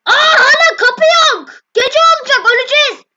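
panicked. Real, not acted. Night falls, monsters coming, no door.

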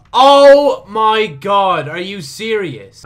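loud, high, very drawn-out cry; long wail of disbelief, easing off; exasperated disbelief. Real, not acted. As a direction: stretch it out slowly in outrage. Game mishap, disbelief.

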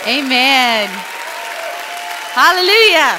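big, projected church cheer; exultant call to the crowd. Real, not acted. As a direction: cheer it out over applause. Cheering after crowd's big welcome.

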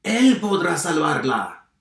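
loud, high exclamation; farmer shouting. A narrator's reading. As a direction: cry it out with conviction. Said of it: "gritó el granjero".

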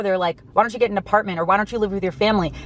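usual speaking voice

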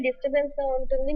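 ordinary talk

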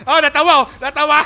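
loud words through laughter; real laugh breaking into the words; laughing taunt. Real, not acted. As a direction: crow at them while laughing. Laughing while mocking rival.

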